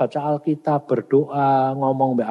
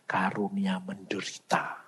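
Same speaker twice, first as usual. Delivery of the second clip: whisper; 7 semitones lower, 13 dB quieter than usual. Acted. Answers own question, hushed.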